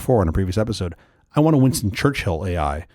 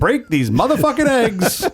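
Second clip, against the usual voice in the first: Samuel L. Jackson; 12 semitones higher, slower than usual. Pretend. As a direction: snarl the recipe like an angry hitman. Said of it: "I want it read back by Samuel L. Jackson".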